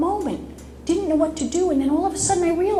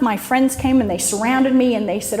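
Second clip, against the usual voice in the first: ordinary talk, nothing marked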